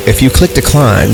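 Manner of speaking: normal talking voice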